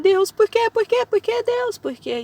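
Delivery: high, loud pleading cry of "why"; a desperate, loud, high plea; anguished cry to God. Retold or imitated. Crying out to God.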